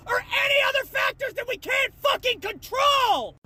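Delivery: high, sustained angry shout; outraged yell. Real, not acted. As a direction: cry out at the injustice. Outrage building to a climax.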